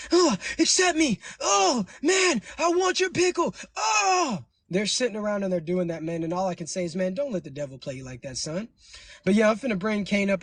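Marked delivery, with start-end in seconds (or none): 0.1-4.4 high, slow mocking falsetto whine; mock begging fan. Streamer mocks pleading female viewers in a high, slow whine. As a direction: ham up a needy fan pleading to be let on. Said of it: "these Keishas can't help it".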